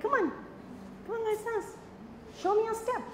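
high-pitched retold coaxing; playful coaxing. Teacher re-enacting coaxing a student to dance, playful high coaxing. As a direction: coax lightly, pitched well up.

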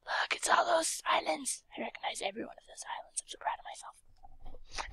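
quick breathless whisper, no voice; whispered aside. Real, not acted. Telling viewers she recognizes the islands, in a whisper. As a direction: share a proud secret in a whisper.

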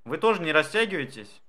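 ordinary talk

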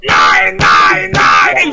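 loud furious German soldier yell; angry German shout. Acted. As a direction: scream it at the troops in rage. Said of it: "He's been practicing the shower techniques".

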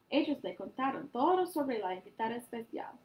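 usual speaking voice

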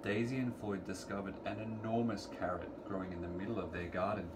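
ordinary delivery

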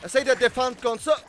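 loud furious yelling at the game; raging gamer. Real, not acted. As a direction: scream curses at the enemies. Raging at enemy fish.

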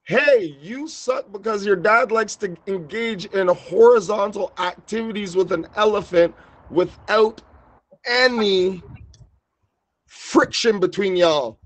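slow, high-pitched pretend holler; mock yelling. Heckling someone across a food court in polite words, mock yelling. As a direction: stretch the words out like a put-on public shout. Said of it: "yelling at the top of my lungs".

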